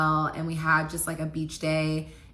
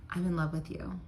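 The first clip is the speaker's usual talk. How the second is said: soft confession; 7 dB quieter than usual. Retold or imitated. Confession at dinner.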